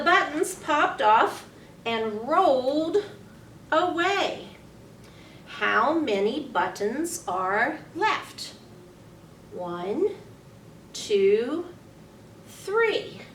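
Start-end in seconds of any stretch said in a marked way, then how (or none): none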